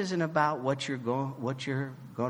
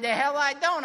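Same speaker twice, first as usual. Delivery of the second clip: retold comeback, higher and louder; indignant retort; 11 semitones higher, 5 dB louder than usual. Younger self answering the old man, in mock indignation. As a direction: toss it back, offended.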